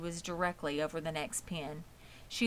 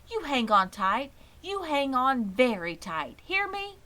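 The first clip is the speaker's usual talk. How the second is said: higher, a fretting mother insisting; anxious mother's warning; 7 semitones higher, 4 dB louder than usual. A narrator's reading. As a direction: insist like a worried mom.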